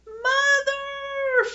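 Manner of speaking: put-on long, very high childish wail. A narrator's reading. Scared girl calling to her mother for help, high wail. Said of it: "Phoebe wailed".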